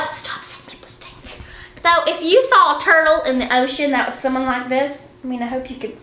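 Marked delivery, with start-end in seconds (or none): 0.1-1.3 whispering. Real, not acted. Whispered aside.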